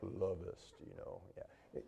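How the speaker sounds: deep, soft, low romantic growl. Pretend. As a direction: purr it low like a soul crooner.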